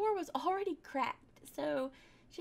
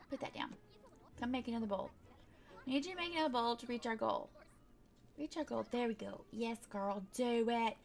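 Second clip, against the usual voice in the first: ordinary delivery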